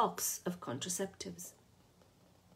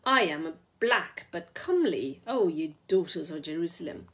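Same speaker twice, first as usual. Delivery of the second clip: louder, proclaiming Bible verse; grand declaiming; 4 dB louder than usual. A narrator's reading. Oxford in the doorway before Lou, grand biblical declaiming. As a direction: announce it like scripture with arms wide.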